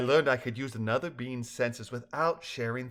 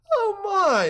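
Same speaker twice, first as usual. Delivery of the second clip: very high, loud cry of dismay; exclaiming; 9 dB louder than usual. A narrator's reading. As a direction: exclaim it, pitch leaping right up. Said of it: "Lizzie exclaimed".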